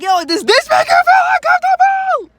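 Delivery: shrieked high and very loud; screaming in her face. Retold or imitated. In the coworker's face, screaming the taunt. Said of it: "I got in her face again".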